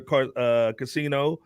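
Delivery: normal talking voice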